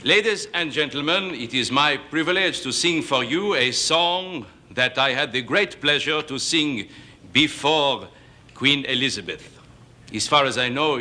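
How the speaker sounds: light mock French crooner voice; hint of a hammy French singer; fake French accent. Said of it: "the great fake French singer".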